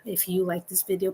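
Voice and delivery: normal talking voice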